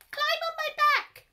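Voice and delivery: very high, loud squeak; a big giraffe with a tiny voice offering help; squeaky giraffe. A narrator's reading. Giraffe squeaking to the animals to climb on. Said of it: "he squeaked in his high voice".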